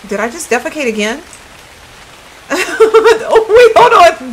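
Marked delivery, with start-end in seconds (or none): 2.5-3.3 high squealing laugh, loud; burst of high laughter. Real, not acted. Streamer cracking up at her own game. 3.3-4.3 high, loud, laughing through the words. Real, not acted. Streamer laughing at her character, still giggling. Laughing at own game mistake.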